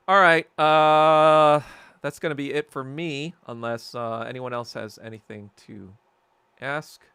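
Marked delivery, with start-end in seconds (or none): none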